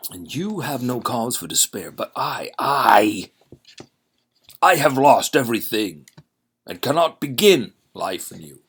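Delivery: a ruined man crying out his loss; despairing stranger, loud and high in his own voice; stranger without the accent. Acted. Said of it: "but this is not Dracula".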